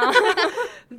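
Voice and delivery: high rising laugh burst. Real, not acted. Laughing at friend's joke. (高声上扬的笑)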